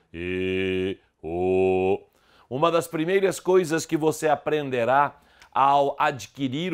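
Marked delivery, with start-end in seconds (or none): 0.1-2.0 E and o held long as closed vowels. Shown as a demonstration. Teacher demonstrating the circumflex sound, closed and held long. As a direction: sustain closed E and o, low and level. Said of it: "indica que a vogal é fechada".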